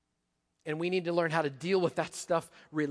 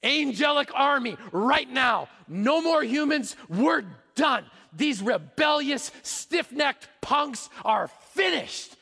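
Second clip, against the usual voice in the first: much louder and higher, slow furious command; Jesus imagined ordering judgment, roaring; commanding shout; 5 semitones higher, 5 dB louder, slower than usual. Retold or imitated. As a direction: call down the angelic army in wrath. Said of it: "when he could have said".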